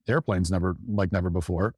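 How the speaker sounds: usual speaking voice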